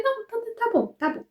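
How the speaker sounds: mock-resigned high voice; high reluctant giving in. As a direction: cave in with a squeaky okay. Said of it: "Mas eu falei".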